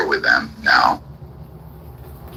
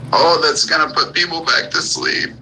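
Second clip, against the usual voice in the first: high, whiny mocking voice of a complainer; high mock whine of a crybaby critic; mock whiny crying; 10 semitones higher than usual. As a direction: mimic him bitching in a much higher put-on whine. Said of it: "he started crying and bitching".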